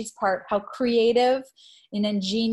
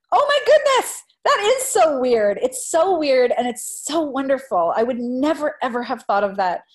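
delighted surprise; 4 semitones higher, 5 dB louder than usual. Retold or imitated. Teacher's delighted reaction to student.

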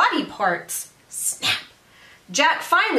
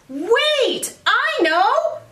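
excited yell; 6 semitones higher than usual. Acted. Jack yells his escape plan, high and excited. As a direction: shout it like you just figured it out. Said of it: "he yelled".

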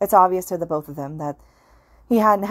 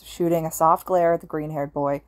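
ordinary delivery

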